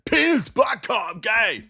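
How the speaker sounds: high, slow hammed-up shout; mock bear comedy. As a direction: parody a dumb joke crowd loudly. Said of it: "that's my impression of bear comedy".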